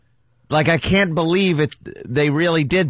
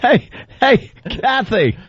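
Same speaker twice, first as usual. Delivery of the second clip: mock Eric call; 8 semitones higher, slower than usual. Voicing Eric calling Kathy.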